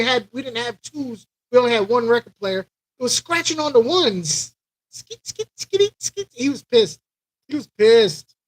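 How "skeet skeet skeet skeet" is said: scratch noise. Retold or imitated. Imitating records being scratched.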